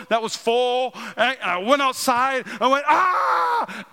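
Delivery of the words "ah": high re-enacted yell of rage; mock roar while hurling a bottle; acted angry yell. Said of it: "I remember I had this anger".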